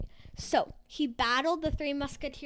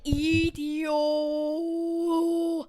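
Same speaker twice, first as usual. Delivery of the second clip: slow, loud threatening roar; angry monster; 2 semitones higher, 11 dB louder, much slower than usual. Acted. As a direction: bellow it slowly like a monster. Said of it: "the angry gas station person said".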